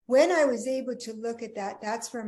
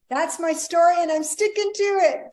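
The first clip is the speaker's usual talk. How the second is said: louder, higher, jokey sign-off; playful quip; 7 semitones higher, 11 dB louder than usual. Real, not acted. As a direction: toss it off with a grin in the voice. Playful tag line to the host.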